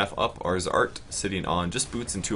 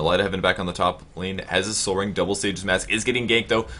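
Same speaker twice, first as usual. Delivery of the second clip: ordinary talk, nothing marked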